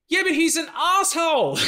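put-on high, loud, snarky girl; imitating a young woman, laughing; young woman's voice. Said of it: "The girl behind me goes".

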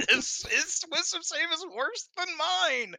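high, laughing disbelief. Real, not acted. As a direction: laugh through the words. Laughing at Trevok's bad save.

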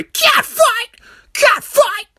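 very high, loud squeal; hammed-up piercing yell; mock excited shout. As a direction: shriek it like a giddy commentator. Mock excited shout during women's match.

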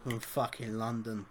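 slow, quiet Cockney; London accent. Shown as a demonstration. Host joining the Cockney bit, slow quiet London accent. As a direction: drag it out in soft London tones. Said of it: "Give us your best London accent".

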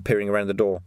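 ordinary delivery

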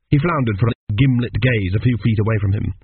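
ordinary delivery

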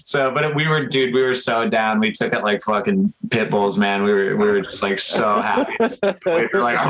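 ordinary delivery

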